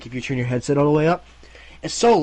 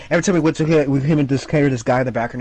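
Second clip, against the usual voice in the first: ordinary delivery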